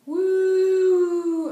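high, loud sung cheer; celebratory woo. Acted. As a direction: cheer the winner. Celebrating ten out of ten.

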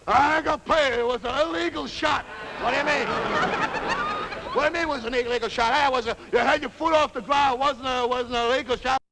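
high-pitched indignant back-and-forth; heated quarrel. Acted. Pool players squabble over an illegal shot, heated. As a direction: argue over each other, protesting.

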